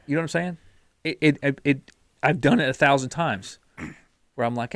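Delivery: ordinary delivery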